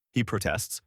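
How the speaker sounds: ordinary delivery, nothing marked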